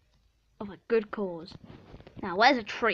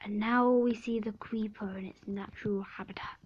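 whispered wildlife narrator; nature documentary; 4 dB quieter than usual. Pretend. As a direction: hush it like a wildlife film.

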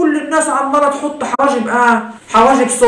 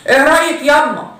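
ordinary delivery

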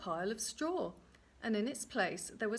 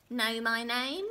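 slow, loud question, swooping up; little man's taunt; 2 semitones higher, 6 dB louder, slower than usual. Acted. Little man quizzes the queen, taunting. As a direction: goad her, rising sharply on the end.

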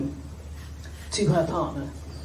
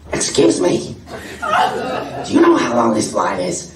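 Marge-like lady voice; 2 semitones higher, 3 dB louder than usual. Retold or imitated. Lady asks Jenny about the flight in a loud, squawky Marge voice. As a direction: put on the squawky Marge voice again. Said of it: "related, I think, to Marge Simpson".

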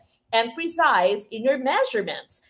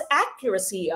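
accuracy stressed on its first syllable, AC-cu-ra-cy; 4 semitones lower, 4 dB quieter than usual. Shown as a demonstration. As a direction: hit the AC at the start of accuracy. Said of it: "nasa first syllable".

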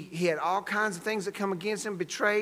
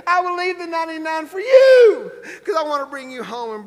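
high, forceful sermon delivery; fervent preaching; 6 semitones higher, 6 dB louder than usual. Real, not acted. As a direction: preach with rising urgency.

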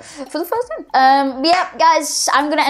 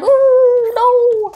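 high, loud mock dismay; imitating the character; 13 semitones higher, 7 dB louder than usual. Retelling how the game character fell, a high mock panic. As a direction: ham up his panic as he falls. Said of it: "the way he was just like".

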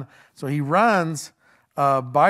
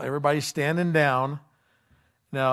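usual speaking voice

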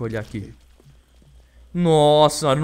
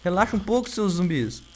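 exasperated; 6 semitones higher, 4 dB louder, slower than usual. Real, not acted. Endless zombies.

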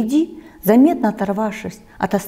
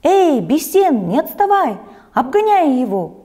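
shouting with all her might; 7 semitones higher, 5 dB louder than usual. A narrator's reading. Grandmother shouting to Besen during the race, full-voiced shout. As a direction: yell across the sports field to spur him on. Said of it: "закричала, что было сил".

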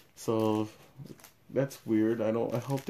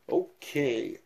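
voice going crazy; 4 semitones higher than usual. Real, not acted. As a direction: voice slips out of control on a drawn-out okay. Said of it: "my voice just went crazy there".

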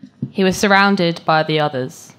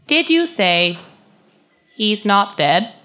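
higher, louder synthetic query; robotic bot voice; 6 semitones higher, 5 dB louder, slower than usual. Acted. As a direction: question it like a machine checking input. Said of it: "bot's robotic voice".

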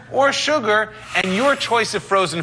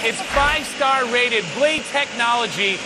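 excited; 6 semitones higher than usual. Real, not acted. Demo host excited at blender.

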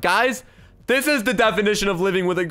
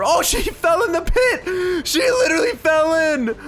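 high, laughing shout of disbelief; shrieking with amusement; 10 semitones higher, 3 dB louder than usual. Real, not acted. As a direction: cry out as someone tumbles into a pit. Player falls into the pit.

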